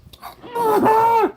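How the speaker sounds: loud, very high whoop of laughter; outburst laugh. Real, not acted. Streamer reacting to Shade Tree's cheesesteak comment, loud laugh.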